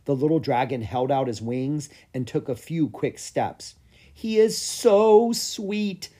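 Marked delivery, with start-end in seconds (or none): 4.1-6.1 much higher, very slow doting voice; gushing. A narrator's reading. Clara watching the dragon's first steps, slow doting gush. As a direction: coo over it lovingly. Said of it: "Clara gushed".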